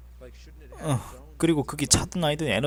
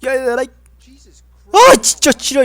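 high yelling at the game; frantic shouting; 8 semitones higher, 8 dB louder than usual. Real, not acted. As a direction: yell in rising panic.

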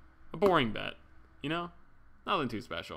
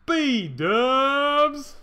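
high, loud drawn-out shout; long, high "B-dubs" yelled out; calling out; 11 semitones higher, 7 dB louder than usual. Real, not acted. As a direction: holler the nickname in greeting. Greeting a friend who joined.

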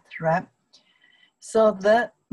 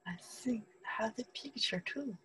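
hushed, whispery aside; aside to self; 2 semitones higher, 14 dB quieter than usual. Real, not acted. Teacher to herself looking for the picture, hushed aside. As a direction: think aloud under your breath.

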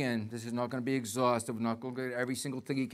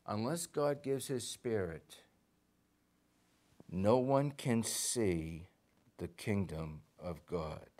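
slow, deliberate rereading; slow reading for the congregation; slowly; 3 semitones lower, slower than usual. Shown as a demonstration. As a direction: lay each word down slowly. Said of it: "let's read it again slowly".

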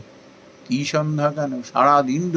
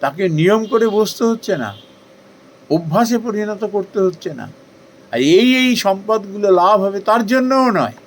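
fervent preaching; 7 semitones higher, 5 dB louder than usual. Acted. Preaching with rising fervour.

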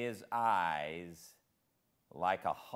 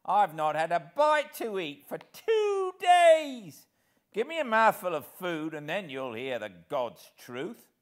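very high, loud whining plea; beggar's whine; 12 semitones higher, 13 dB louder than usual. Acted. Hero posing as beggar to old villain, loud whine. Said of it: "in a real beggar's whine".

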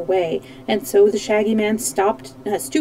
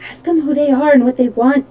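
ordinary delivery, nothing marked

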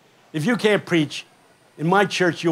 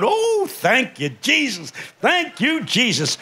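slow high oh, thank you Jesus rising higher; grateful grandparents; 7 semitones higher, slower than usual. Retold or imitated. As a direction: praise God with lifted voice. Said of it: "grandmothers and grandfathers go".

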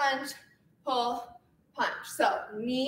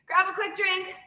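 ordinary talk, nothing marked

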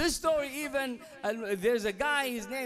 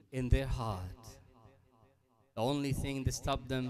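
solemn sermon; 8 semitones lower, 4 dB quieter than usual. Acted. Preacher reflecting to the congregation on arrogance, low and solemn. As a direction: let each phrase sink in with long pauses.

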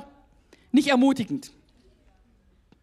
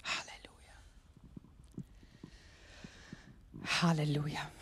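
hushed praise; 10 semitones lower, 20 dB quieter than usual. Real, not acted.

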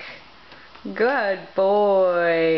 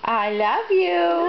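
high, slow sing-song love you; tender; 9 semitones higher, slower than usual. Shown as a demonstration. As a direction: coax it out slowly for a toddler. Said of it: "Can you say".

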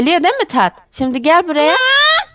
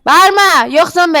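loud, higher-pitched telling-off; scolding shout; 5 semitones higher, 5 dB louder, slower than usual. Acted. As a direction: shout back to silence him. Wife yells back at boy. (azarlayarak bağırma)

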